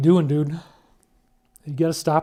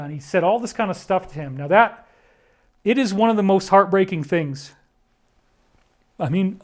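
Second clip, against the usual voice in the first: normal talking voice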